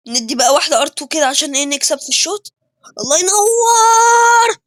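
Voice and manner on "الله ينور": high, loud, very slow praise; drawn-out praise. Real, not acted. Gamer cheering a great move, high drawn-out delight. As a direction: stretch it out in delight at the play.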